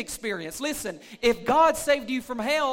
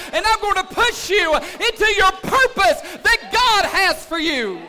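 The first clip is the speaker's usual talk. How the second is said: louder and higher, driving 'push you into your purpose'; loud preaching take; 8 semitones higher, 10 dB louder than usual. Real, not acted. Pastor rousing the church, loud preaching climax. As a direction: proclaim it at full force.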